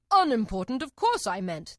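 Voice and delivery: loud, quick correction; hasty king. A narrator's reading. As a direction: cover the mistake hastily. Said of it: "the king hastily said".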